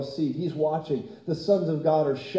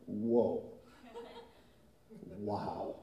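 awed whoa; 8 semitones lower, 13 dB quieter than usual. Acted. Angels awed at creation.

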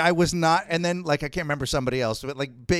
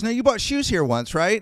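imitating shop girl; 7 semitones higher, 3 dB louder than usual. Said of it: "And she goes".

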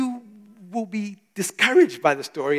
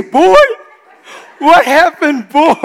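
much higher and louder, laughing; excited dad; 7 semitones higher, 8 dB louder, slower than usual. Retold or imitated. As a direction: burst out with eager delight. Excited dad greeting son.

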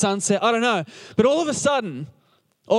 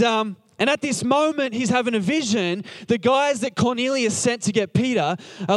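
ordinary delivery

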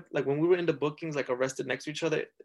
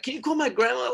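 high, urgent plea for grandma; pleading request; 10 semitones higher, 4 dB louder than usual. Retold or imitated. Scared teen in lockup begging for grandma, high plea. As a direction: push the ask up high, almost whining.

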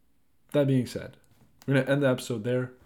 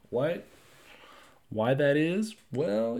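normal talking voice

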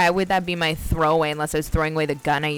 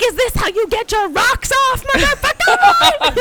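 high, loud, raging yell with a laugh; furious shout; 6 dB louder, slower than usual. Retold or imitated. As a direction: scream it at him, outraged and laughing. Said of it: "I can see you going nuts".

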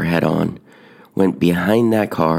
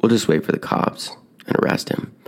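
bored dismissal; 3 semitones higher than usual. Retold or imitated. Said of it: "And they're like".

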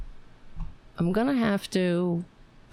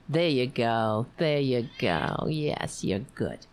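lower, tender pet talk; low soothing praise; 6 semitones lower, slower than usual. Real, not acted. Owner praises the dog for the paw, low and tender.

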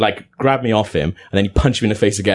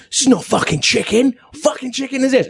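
high, indignant shouted complaint; a drunk customer rages at the pizza guy, high mock shout; mock angry yell. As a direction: bellow it like an outraged drunk.

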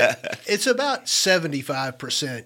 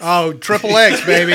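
excited; 3 semitones higher, 10 dB louder than usual. Pretend. Playful hype about rating.

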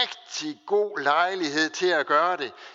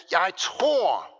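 emphatic declaration. Retold or imitated. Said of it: "satte han benet sådan hårdt ned i jorden".

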